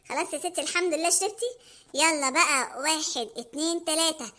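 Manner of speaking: low Hamada countdown. Acted. Hamada counting down the run to Mona. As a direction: count down the escape quietly.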